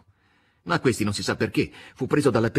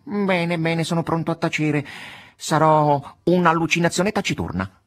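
cat voice; 7 semitones higher, 4 dB louder than usual. A narrator's reading. Cat agreeing to stay silent.

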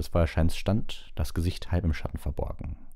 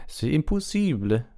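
high, slow French protest; indignant Frenchwoman; 11 semitones higher than usual. A narrator's reading. French headmistress protests, outraged. (empörte Französin, hohe Stimme)

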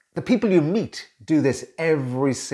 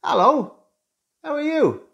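bright, much higher sing-song hello; friendly greeting; 14 semitones higher than usual. Shown as a demonstration. Coach showing how you greet someone in the street, friendly and higher. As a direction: lilt the greeting up high like a friendly neighbour. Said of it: "No, you say".

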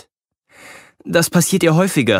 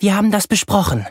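mother's warning; 7 semitones higher than usual. A narrator's reading. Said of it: "meldet Mama sich von der Spüle".

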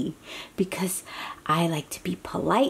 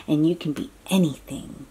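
ordinary delivery, nothing marked

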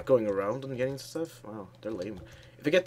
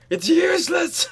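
yelling in frustration, high; frustrated shout; 7 dB louder than usual. Real, not acted. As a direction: shout it out, exasperated. Frustrated gaming outburst.

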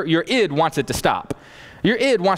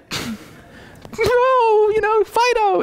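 much higher, sweet talking to a pet; much slower than usual. Retold or imitated. Dog owner calling her pet back, high and sweet.

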